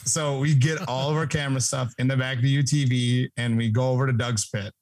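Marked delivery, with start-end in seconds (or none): none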